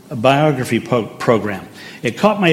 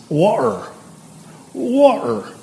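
Cockney WATER with the T dropped; Cockney glottal gap in place of the T in "water"; Cockney accent; 9 semitones higher, slower than usual. Shown as a demonstration. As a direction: drop the middle T in water, Cockney style. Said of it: "the T is dropped in the middle".

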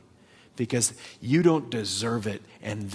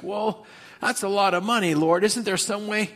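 higher, coaxing voice pleading with the Lord; wheedling Balaam; 6 semitones higher than usual. Retold or imitated. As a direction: play Balaam angling for the money, lightly. Balaam tempted by more money.

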